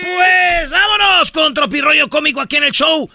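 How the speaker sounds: high, shouted show opening; hyped announcer shout. Acted. Host launching the comedy segment, high shouted announcement. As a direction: holler it with a long opening whoop.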